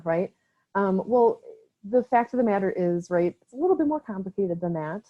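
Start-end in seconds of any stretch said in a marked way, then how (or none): none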